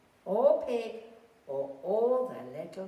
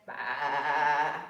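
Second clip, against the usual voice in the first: a louder sheep's baa; bleating; 5 semitones lower, 6 dB louder than usual. Acted. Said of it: "Sheep went".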